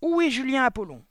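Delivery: loud, high barked demand; fierce shouted summons; abrupt bark. A narrator's reading. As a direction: bark it across a packed hall. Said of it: "aboya brusquement Le Gourdin".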